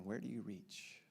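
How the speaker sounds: very soft, low question; quiet and heavy, barely projected; sadder. Acted. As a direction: ask it in a sad hush. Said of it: "It's getting sadder, isn't it?".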